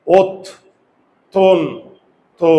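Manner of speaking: ওত, তন, তো as three loud, separate beats; teacher chopping the word into "ot, ton, to"; syllable breakdown. Shown as a demonstration. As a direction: punch out ওত... তন... তো with pauses. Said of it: "তিনটা syllable".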